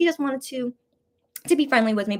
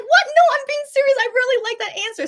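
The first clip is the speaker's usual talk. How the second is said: insistent protest; 6 dB louder than usual. Retold or imitated. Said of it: "I'm like".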